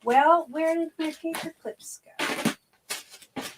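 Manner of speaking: high, slow, puzzled wondering; drawn-out where-did-it-go; exasperated searching. Real, not acted. Searching the box for clips.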